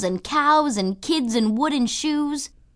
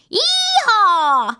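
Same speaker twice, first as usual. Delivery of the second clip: high, loud cowboy whoop; a kid yelling as she dodges; loud, high play shout; whooping yee-haw; 11 semitones higher, 5 dB louder than usual. A narrator's reading.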